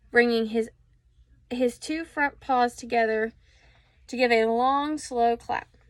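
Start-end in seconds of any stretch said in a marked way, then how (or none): none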